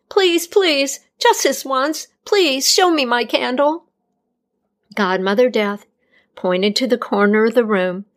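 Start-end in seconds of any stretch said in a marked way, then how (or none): 0.1-3.9 put-on higher, urgent pleading young woman; pleading healer. A narrator's reading. Healer begging Death to show her candle, pleading. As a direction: beg with rising panic.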